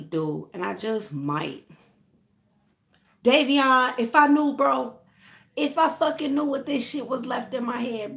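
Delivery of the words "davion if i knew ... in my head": raised, higher voice, fed up; loud rant. Real, not acted. Venting to Davion about leftover residue in his hair, loud fed-up rant. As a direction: blow off steam at him.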